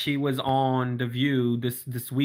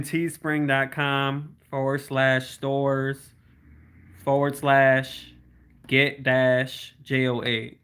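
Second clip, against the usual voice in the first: slow, each part spelled apart; slow spelled-out dictation; much slower than usual. Shown as a demonstration. Telling a viewer the merch link to type, slowly. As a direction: dictate it slowly so they can type along. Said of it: "Type in".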